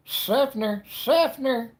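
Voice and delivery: high, loud, hammed-up "Seffner"; exaggerated town name. Shown as a demonstration.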